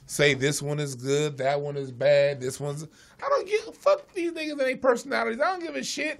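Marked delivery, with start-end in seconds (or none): 3.2-6.2 high, exasperated I-don't-care voice; dismissive rant. Acted. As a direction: brush it all off with disdain. Mocking fans' obsession.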